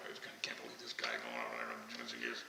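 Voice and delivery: the religious crowd grumbling at Jesus visiting a sinner, low mock grumble. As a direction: grumble it under your breath like a sour onlooker. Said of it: "I love the grumbling".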